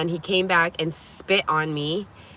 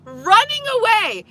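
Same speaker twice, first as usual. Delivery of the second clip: ordinary delivery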